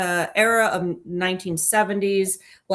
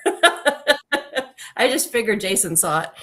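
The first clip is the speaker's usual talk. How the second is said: laughing; 3 semitones higher, slower than usual. Real, not acted. Teasing Jason, laughing.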